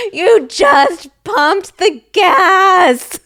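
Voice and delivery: high, slow, loud disgusted cry; disgusted disbelief. Retold or imitated. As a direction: recoil at the gross partner, drawn out and loud. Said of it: "And I was like".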